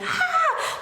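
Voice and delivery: sharp high pained cry; high scream of pain. Retold or imitated. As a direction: yelp as if in sudden pain. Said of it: "he starts screaming in pain".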